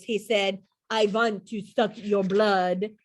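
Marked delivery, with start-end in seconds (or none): 0.9-2.8 slow, put-on vampire accent. A narrator's reading. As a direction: play a kid's best vampire, drawing out each word. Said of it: "in his best vampire voice".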